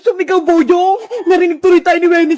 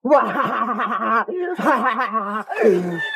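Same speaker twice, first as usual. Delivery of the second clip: long, low villainous cackle; evil laugh; 7 semitones lower, 6 dB quieter than usual. Acted. Villain unharmed by water splash, mocking cackle.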